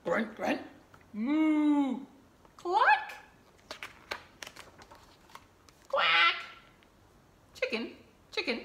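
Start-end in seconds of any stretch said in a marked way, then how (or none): 1.1-2.0 higher, loud mock moo; cow moo. Cow sound in book. 2.6-6.3 high, loud hen cluck and duck quack. Acted. Animal sounds in book.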